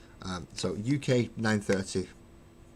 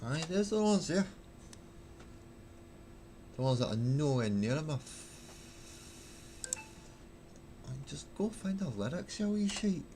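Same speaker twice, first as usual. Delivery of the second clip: grumbling in Glaswegian; soft Glaswegian aside; Glaswegian accent; 2 semitones higher, 7 dB quieter than usual. Shown as a demonstration. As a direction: mutter to yourself in a Glasgow accent. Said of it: "stuck in the Glaswegian accent".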